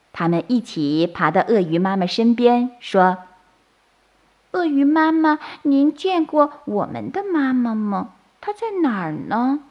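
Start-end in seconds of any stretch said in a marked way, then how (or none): 4.5-9.6 put-on high, childlike questioning; baby turtle voice. A narrator's reading. Baby turtles ask crocodile mother where mum is, childlike pleading. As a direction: ask like a little lost hatchling.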